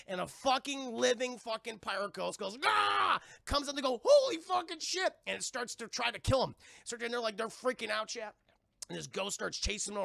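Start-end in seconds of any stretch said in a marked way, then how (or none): 2.7-3.2 loud, high roaring scream; mock ghost roar. Pirate ghost bursts out. 4.0-5.1 loud, high-pitched mock alarm; shocked yell. Kids see the living ghost.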